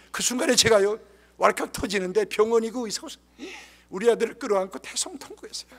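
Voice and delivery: ordinary talk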